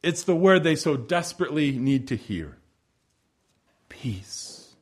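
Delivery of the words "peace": a single soft whispered Peace. Acted. Jesus speaking peace to fearful disciples, whispered. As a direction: breathe the word Peace. gently. The word they so desperately need.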